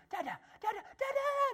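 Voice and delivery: put-on a little boy delighted his dad is home; excited toddler. Father imitates his toddler greeting him at the door, squealing. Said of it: "and Lukey goes".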